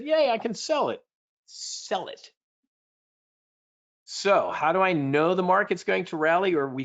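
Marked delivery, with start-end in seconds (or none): 0.0-1.0 high, gleeful cheer; playful sell-high glee; excited. Acted. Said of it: "cheering selling volatility". 1.5-2.3 "Sell it" whispered as a secret tip; "Sell it" whispered conspiratorially. Shown as a demonstration. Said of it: "One more time, I'll say it".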